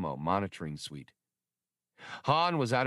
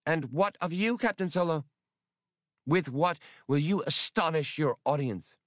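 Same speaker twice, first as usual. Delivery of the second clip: higher, defiant challenge; defiant Atwari; 8 semitones higher than usual. Acted. Atwari challenging Captain Solo, defiant. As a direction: throw the question back at him. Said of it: "a defiant stare".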